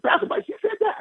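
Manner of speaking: surprised disbelief. Retold or imitated. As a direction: ask it in high astonishment.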